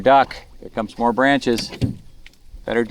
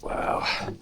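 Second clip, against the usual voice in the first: whispered; 3 semitones lower, 5 dB quieter than usual. Real, not acted. Squeezing under branches in creek.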